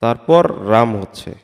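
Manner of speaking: usual speaking voice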